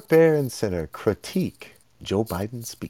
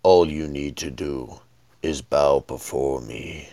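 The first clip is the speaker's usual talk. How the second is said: dark lord; 9 semitones lower, 3 dB quieter than usual. Retold or imitated. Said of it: "and he's like".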